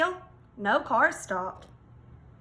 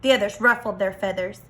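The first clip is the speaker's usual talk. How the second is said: ordinary delivery, nothing marked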